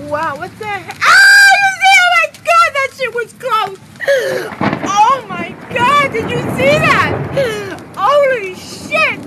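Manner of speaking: loud, high panicked yells, close to crying; real terrified shouting at a close strike; scared shouting. Real, not acted. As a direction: scream it like the bolt just missed you. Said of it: "I'm scared".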